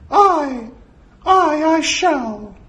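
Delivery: loud, solemn falsetto vow; earnest cartoon-mouse falsetto; Mickey. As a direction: pledge obedience in a high squeak.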